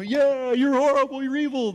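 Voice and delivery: high, loud jeering at a politician; mock heckling. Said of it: "I get in".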